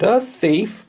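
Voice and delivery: high, loud offended cry; outraged. Acted. Knight rejects the accusation, loud and outraged. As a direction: fling it back in outrage.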